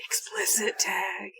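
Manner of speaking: slow unvoiced whisper. Real, not acted. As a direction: breathe it as an aside. Whispered aside during the game.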